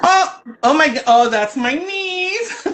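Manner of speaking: high, drawn-out happy exclamation; excited delight. Real, not acted. Spotting her niece, excited and delighted. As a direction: gush it with a laugh in the voice.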